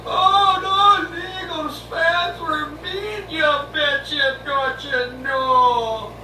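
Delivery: high, slow mock Minnesota whine; whiny Minnesota accent. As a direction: wail it like a sore Minnesota loser. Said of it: "it was all".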